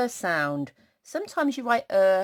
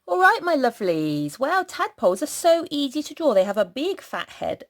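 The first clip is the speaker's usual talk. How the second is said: cheery teacher voice; 9 semitones higher, 6 dB louder than usual. Real, not acted. Greeting children brightly.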